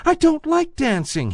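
high, small rabbit voice; little toy rabbit. A narrator's reading. Toy rabbit insisting to the wild rabbit, high small voice. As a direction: pipe up like a tiny toy bunny. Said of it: "he said".

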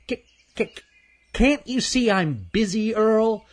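very high, annoyed squirrel voice with a stutter. Acted. Squirrel brushes off the nosy chipmunk, high irritated stutter. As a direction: stammer it out in a high, testy squeak.

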